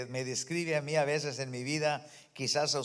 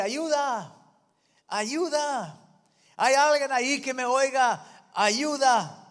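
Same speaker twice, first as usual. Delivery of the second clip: pedir ayuda a gritos, más agudo y fuerte; gritos de auxilio; 8 semitones higher, 5 dB louder, slower than usual. Retold or imitated. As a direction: grita desesperado colgado de una rama. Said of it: "Gritaba este hombre". (hombre colgado grita pidiendo ayuda)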